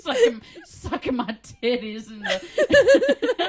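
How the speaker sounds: high, slow words through laughter; laughing mock confession. Joking about being glamored in the Winn-Dixie, laughing helplessly. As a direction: crack up while playing the victim.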